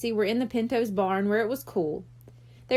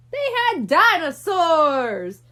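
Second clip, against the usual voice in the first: put-on excited kid yell, slow and louder; 4 semitones higher, 6 dB louder, slower than usual. A narrator's reading. Brian yelling his joke idea to his friends. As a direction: shout it out like a silly kid. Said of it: "Brian yelled".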